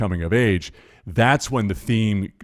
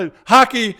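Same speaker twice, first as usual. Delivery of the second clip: much higher and louder, bright exclamation; excited wife's guess; 7 dB louder than usual. Retold or imitated. Wife walking past guesses the sport, loud excited call. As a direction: call it out like you've cracked the code. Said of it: "and she'd go".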